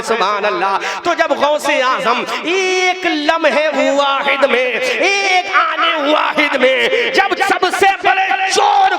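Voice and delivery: loud, impassioned sermon climax, slowed on key words; full-force preaching. Real, not acted. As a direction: preach it to the back of the hall.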